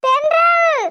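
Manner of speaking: frantic, tearful yells for rescue; shouting for help. Acted. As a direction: cry out to anyone who might be near. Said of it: "ஏன் இப்படி நின்னு கத்திக்கிட்டு இருக்க?".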